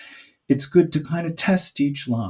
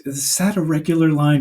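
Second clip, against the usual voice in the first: ordinary delivery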